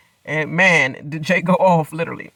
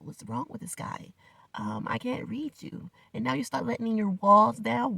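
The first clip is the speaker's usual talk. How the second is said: whispered, much softer and slower; hushed mock thought; 7 semitones higher, 5 dB quieter than usual. Inner reaction to Jay's flirting, whispered and slow. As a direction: breathe it like a secret thought.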